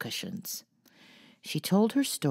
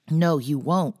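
louder sudden protest; alarmed louder no; cried out; 2 semitones higher, 8 dB louder than usual. A narrator's reading. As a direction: cry out to stop it. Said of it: "cried Jo".

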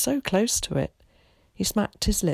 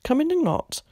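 ordinary delivery

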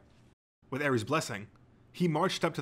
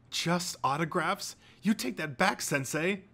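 higher-pitched indignant protest; 6 semitones higher, slower than usual. A narrator's reading. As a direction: object like an affronted fanboy. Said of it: "with an indignant snort".

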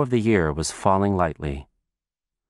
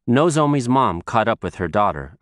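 ordinary delivery

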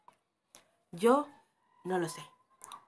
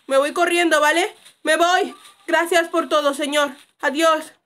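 loud, raised-pitch calling; hasty shouted farewell; 5 semitones higher, 10 dB louder, slower than usual. Real, not acted. Player runs from neighbour saying thanks, shouted farewell. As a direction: shout your polite exit while fleeing.

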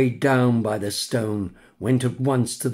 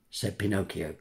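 usual speaking voice

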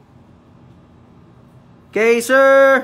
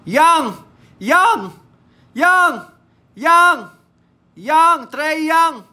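held-back excited yell; 4 semitones higher, much slower than usual. Real, not acted. Card breaker cheering a pulled player, held-back yell. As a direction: yell the name but hold back the full shout. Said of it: "Di ako makasigaw ng maayos".

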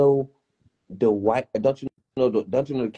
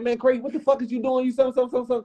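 pitched-up retold scolding; berating a friend, mock; high mock scolding; 8 semitones higher, much faster than usual. Scolding Craig in retold scene.

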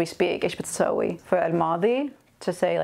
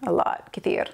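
whispered. Shown as a demonstration. Whispered model of the word.

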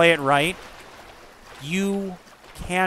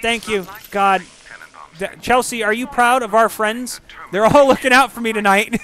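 normal talking voice

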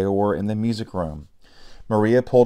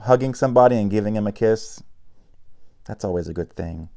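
usual speaking voice

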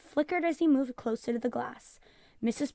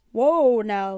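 firm whoa; 10 dB louder than usual. Acted. Father stopping the daughter's request.

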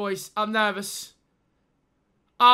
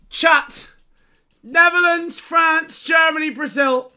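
loud, higher excited listing; excited call-out; 4 semitones higher, 6 dB louder than usual. Real, not acted. Streamer shouting nations to chat, excited. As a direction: rattle off the countries hyped. Naming flags at reveal.

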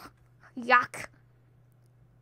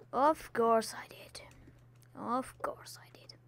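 soft self-mocking whisper; a hushed, rueful aside; 9 dB quieter than usual. Real, not acted. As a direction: whisper it to yourself.

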